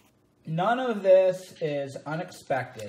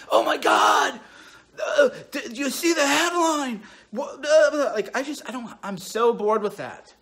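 high, loud hammed-up panic over headlines; mock panicked person; 6 semitones higher, 5 dB louder than usual. Mocking a panicky headline reader, frantic and loud. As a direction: ham up a frantic news addict. Mocking people freaking out daily.

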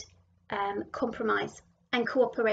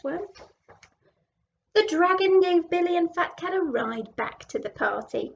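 ordinary delivery, nothing marked

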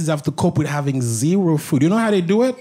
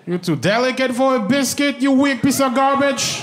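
high-pitched, hammed-up scolding; exaggerated disgust, pitched up; mock scornful insult; 7 semitones higher than usual. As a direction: berate a weakling for comic effect. Mock insult of bread-intolerant person.

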